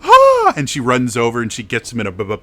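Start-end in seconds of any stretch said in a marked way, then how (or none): 0.0-0.5 loud, high-pitched delighted squeal; excited greeting cry. Acted. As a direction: cry out the name as an overjoyed daughter. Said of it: "you see her go".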